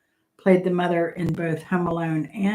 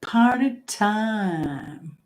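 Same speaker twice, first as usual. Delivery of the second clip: "Party time." said very slowly, savoring both words; drawn-out party time; 2 semitones higher, much slower than usual. Real, not acted. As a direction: stretch it out like a celebration. Host repeats winning answer drawn out.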